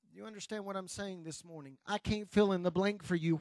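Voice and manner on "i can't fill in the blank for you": normal talking voice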